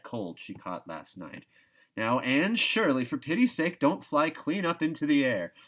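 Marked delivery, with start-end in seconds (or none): 2.0-5.5 put-on raised, scolding warning to an excitable girl; a stern aunt calling her down to earth; Marilla warning. A narrator's reading. Marilla scolds Anne to stay calm, raised warning. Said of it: "The warning seemed not unnecessary".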